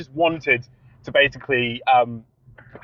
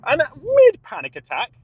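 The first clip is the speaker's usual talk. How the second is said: ordinary talk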